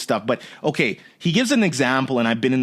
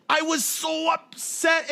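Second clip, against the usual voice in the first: much higher, slow and dragged out; hammed-up wounded pride; mock upset; 13 semitones higher, 3 dB quieter, much slower than usual. Speaker retelling his anger at the airline, high and slow. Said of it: "So he's like".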